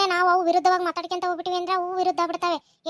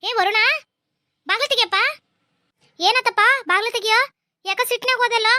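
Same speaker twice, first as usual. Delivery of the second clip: calling out; 4 semitones higher, 6 dB louder than usual. Acted. Mother calling son through door.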